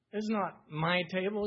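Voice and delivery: usual speaking voice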